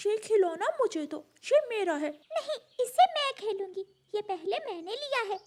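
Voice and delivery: put-on high, insistent child-bird voices; squabbling siblings. Siblings fighting over a toy, squabbling. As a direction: claim it like a possessive child.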